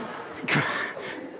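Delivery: breathy chuckle; soft real laugh. Real, not acted. Pastor laughing at his own frosting confession, soft chuckle. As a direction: laugh at yourself quietly.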